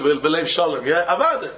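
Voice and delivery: ordinary talk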